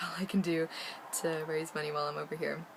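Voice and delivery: ordinary delivery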